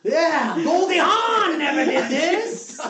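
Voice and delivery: high, very slow, hammed-up hollering; Al Pacino impression. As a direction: swoop up and stretch every phrase. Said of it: "still blowing Pacino. He's going".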